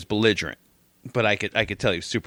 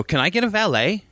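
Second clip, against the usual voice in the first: entitled lady; 8 semitones higher than usual. Retold or imitated. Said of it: "walk up and go".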